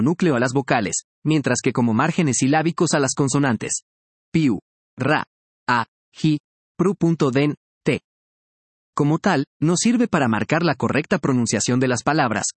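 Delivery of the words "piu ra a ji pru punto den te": Piu, ra, a, ji, pru punto den, te broken into separate beats; syllable by syllable. Shown as a demonstration. Teacher showing syllables to students, each one apart. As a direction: space out every syllable, Piu, ra, a, ji. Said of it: "La sílaba es una unidad de la pronunciación".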